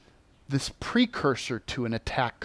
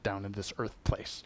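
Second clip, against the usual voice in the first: lower, softer, fast careless brush-off; dismissive exploiter; 6 semitones lower, 7 dB quieter than usual. Retold or imitated. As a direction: shrug it off like a lazy rule-breaker. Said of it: "They're like".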